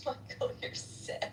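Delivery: soft, slow, whispered copy of the scold; teasing whispered echo of the scold; mock whisper echo. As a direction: breathe the line back as a teasing echo. Echoing the scold back.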